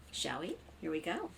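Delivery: plain reading voice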